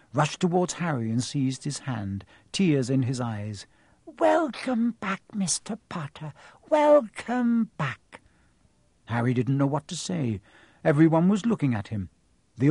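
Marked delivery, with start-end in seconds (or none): 4.2-8.2 high, very slow, choked-up greeting; tearful welcome. Acted. Tearful bartender welcoming Harry back, slow and high. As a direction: welcome him home through tears. Tears in his eyes.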